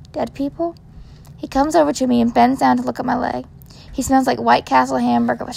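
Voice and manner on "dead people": soft, small, scared question; frightened echo. Acted. Injured girl overhears the medic, small scared echo. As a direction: repeat it under her breath, shaken. Child shocked whisper question.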